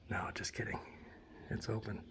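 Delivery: very soft whisper. Real, not acted. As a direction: hush it right down. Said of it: "I have to whisper".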